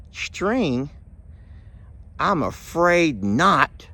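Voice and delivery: slow, deliberate I'm afraid not; string answering the bartender with a pun; punchline delivery. Acted. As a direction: land the pun slowly. Punchline, frayed knot pun.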